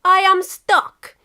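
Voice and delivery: put-on slow, loud heavy Russian accent; deliberate Russian complaint. Russia calling out from his tank hatch, heavy Russian accent. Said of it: "replied Russia".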